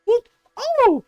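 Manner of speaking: high, flustered stammer; sputter, lost for words; mock flustered neighbor.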